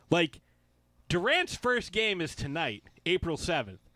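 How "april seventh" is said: usual speaking voice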